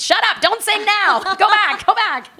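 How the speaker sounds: high sharp voice of the inner critic, hushing her; scolding inner voice. Retold or imitated. Inner critic silencing her childhood dream, in a high scolding voice. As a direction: snap at the child inside to stop singing.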